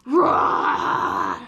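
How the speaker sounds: loud, deep growling roar; big playful lion roar. Pretend. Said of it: "Can you roar like a lion?".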